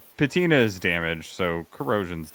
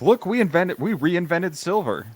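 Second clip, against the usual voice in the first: mock brand announcement; 9 semitones higher, 3 dB louder than usual. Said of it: "when Tudor's like".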